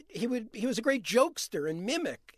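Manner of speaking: ordinary delivery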